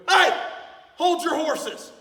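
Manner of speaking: high, loud holler; yelled Southern warning. Shown as a demonstration. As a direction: yell it to halt someone rushing. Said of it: "you even yell it and you say it".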